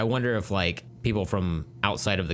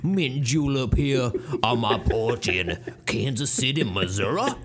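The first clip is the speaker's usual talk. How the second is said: hammed-up Southern gentleman drawl; Southern drawl, mint julep on the porch; 2 semitones higher than usual. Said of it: "so Southern drawl".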